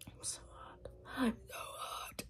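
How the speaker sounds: breathy whispered complaint, barely voiced. Real, not acted. Vlogger whispering to viewers how hot she is. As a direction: whisper it like you're wilting from the heat.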